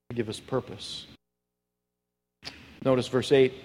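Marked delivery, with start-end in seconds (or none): 0.1-1.1 quiet echo. Real, not acted. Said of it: "quiet repeat".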